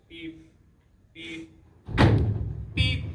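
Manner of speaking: high-pitched studio cue beeps; imitated beeps. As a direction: mimic the beeps, the last one loudest. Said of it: "there's three beeps".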